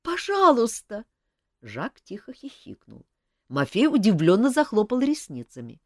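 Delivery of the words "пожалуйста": high, slow, begging; girl begging the king through tears; tearful plea. Acted. Sobbing girl begs king.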